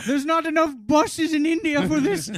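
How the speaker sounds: a hint of a mock Indian accent, high; lightly put-on Indian accent. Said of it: "you just did a really offensive accent there".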